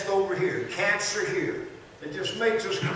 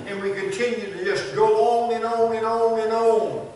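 loud, rising preacher cadence, each line hammered; full-force preaching; 3 semitones higher, 5 dB louder than usual. Acted. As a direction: build the crowd up line by line. Pastor builds to full preaching.

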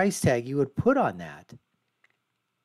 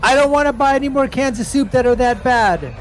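loud, high mocking protester voice; mocking protester voice-over; 9 semitones higher, 4 dB louder than usual. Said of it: "I'm gonna voice over this thing here".

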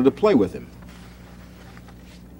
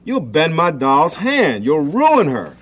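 higher, louder, slow protest; upset scolding; 5 semitones higher, 4 dB louder, slower than usual. A narrator's reading. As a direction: fret over the ruined doll. Marcia scolds Sam.

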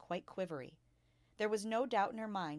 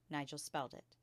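ordinary delivery, nothing marked

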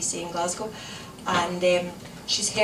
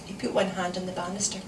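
ordinary delivery

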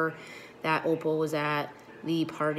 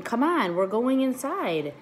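high, louder call, slowing at the end; hollered over the storm; 8 semitones higher, 5 dB louder, slower than usual. A narrator's reading. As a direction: call him in over the rain. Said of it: "I hollered at him over the rain".

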